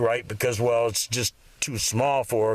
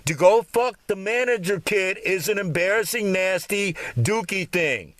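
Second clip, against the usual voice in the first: ordinary talk, nothing marked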